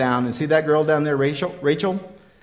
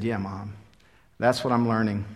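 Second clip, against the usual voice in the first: soft, low, gentle answer; tender reply; 5 semitones lower, 5 dB quieter than usual. Real, not acted. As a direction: tell her gently and softly.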